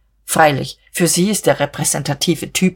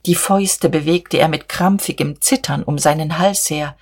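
ordinary delivery, nothing marked

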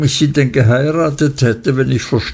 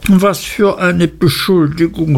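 higher, mildly shocked outcry; a touch of wounded outrage; appalled exclamation; 6 semitones higher than usual. Acted. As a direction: act out her horror, lightly. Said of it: "rief Dora aus und sah ganz entsetzt drein".

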